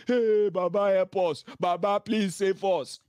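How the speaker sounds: mimicking people begging Baba to save them; mock crying out. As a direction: imitate a desperate plea to Baba. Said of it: "The day you cry out".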